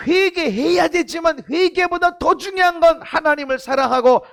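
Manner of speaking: ringing pulpit voice, pressing each point; preaching at full force. Acted. Pastor urging the congregation, preaching at full force. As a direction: preach it out to the whole hall.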